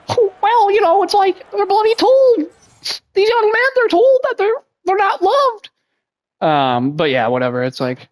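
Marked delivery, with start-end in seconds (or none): 0.1-5.7 mock lecturer voice. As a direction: ham up a preachy pundit. Keeps up the Peterson parody.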